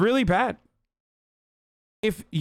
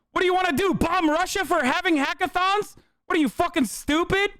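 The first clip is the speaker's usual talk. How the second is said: much higher, scoffing disbelief; incredulous mockery; 12 semitones higher than usual. Real, not acted. Streamer mocking a chatter's bombing idea, incredulous and much higher. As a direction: act like the idea is absurd.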